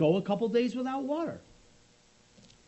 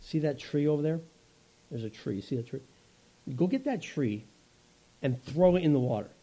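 patient God explaining a plan to Moses; God gently tells Moses to throw the tree in; voice of the Lord; 4 semitones lower than usual. Acted. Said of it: "And the Lord says".

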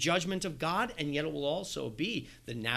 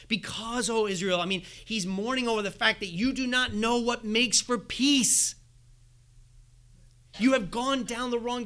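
raised, driving preacher's voice at full force; impassioned preaching; 6 semitones higher, 4 dB louder than usual. Acted. Pastor warning the congregation that their idols lead to destruction, preaching at full force. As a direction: plead with them from the pulpit.

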